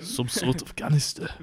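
mock South African echo; South African accent. Teasing co-host by echoing his South African accent. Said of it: "South African".

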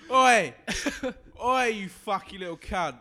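high, loud "Oi!" and a barking lout's snarl; aggressive pub lout. Acted. Drunk lout in his pub picking a fight, loud barking snarl. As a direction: bark it like a drunk looking for a fight. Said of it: "You imagine saying that in the pub".

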